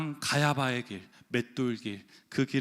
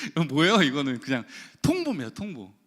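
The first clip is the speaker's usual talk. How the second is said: louder, laughing incredulous question; laughing protest; 5 dB louder than usual. Real, not acted. Preacher protesting to congregation about being simply notified, laughing. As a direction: ask it on the tail of a laugh.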